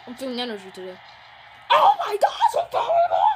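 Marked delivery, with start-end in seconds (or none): 1.7-3.2 very high, loud laughing shriek; hyper laughing shout. Acted. Hyper Derek shrieking with laughter at the game. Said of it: "Hyper Derek".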